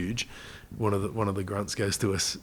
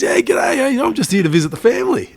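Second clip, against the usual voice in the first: much higher, louder cheerful Aussie twang, imitated; mock Aussie accent; 8 dB louder than usual. Local man telling soldiers he's visiting family, in a high, loud mock Aussie accent. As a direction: chirp it like a local who picked up Aussie slang. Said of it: "he had a full-on accent".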